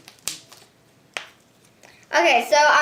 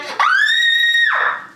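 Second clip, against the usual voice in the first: scream. Real, not acted. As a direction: shriek at the eruption. Slime erupting.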